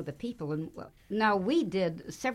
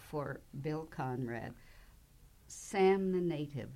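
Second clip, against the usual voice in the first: ordinary talk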